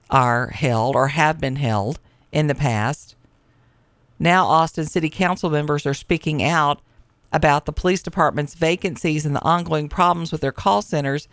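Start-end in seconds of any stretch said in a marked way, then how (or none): none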